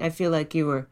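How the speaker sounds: usual speaking voice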